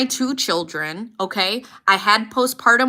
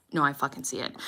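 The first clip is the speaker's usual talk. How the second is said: low, hushed confirmation; 6 semitones lower, 10 dB quieter, faster than usual. Retold or imitated. Mother-in-law confirming, low voice.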